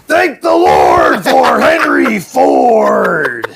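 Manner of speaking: strained, high shouted promo roar; over-the-top drawn-out promo shout; monster truck voiceover. Acted. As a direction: belt it out slowly like an arena announcer. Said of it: "doing that voiceover hurt my throat".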